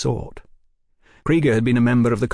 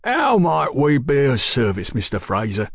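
obliging publican; 3 semitones higher than usual. Acted. Sayers obliging the policeman.

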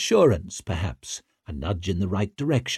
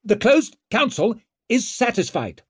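high, raised official pronouncement; strained call for an angry hall; struggling to be heard; 12 semitones higher than usual. A narrator's reading. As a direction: project over the uproar. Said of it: "struggled to make himself heard over the anger".

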